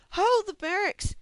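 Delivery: high, loud hail across a field; shouting for help across the pasture; yelling from afar. A narrator's reading. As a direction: call out to a distant building. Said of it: "she yelled".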